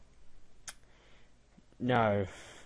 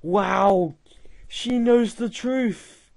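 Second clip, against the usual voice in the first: mock amazement; 6 semitones higher, 4 dB louder, slower than usual. Real, not acted. Mock amazement at chatbot answer.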